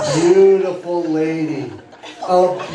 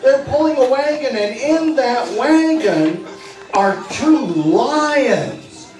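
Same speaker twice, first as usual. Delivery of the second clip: excited parade story; 5 semitones higher than usual. Acted. Hypnotist walking the six-year-olds through the zoo parade, bright and eager. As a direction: paint the parade like a thrilled children's entertainer.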